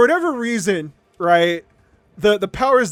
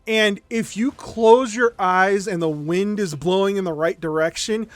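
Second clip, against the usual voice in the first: normal talking voice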